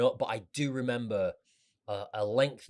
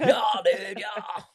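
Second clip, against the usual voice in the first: excited; 7 semitones higher, much slower than usual. Pretend. Joking heavy metal cheer.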